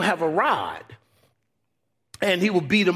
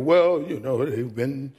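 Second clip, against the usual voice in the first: mock funeral mourner; 5 semitones lower than usual. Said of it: "at the next funeral".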